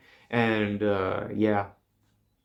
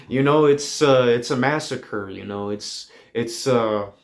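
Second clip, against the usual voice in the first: ordinary talk, nothing marked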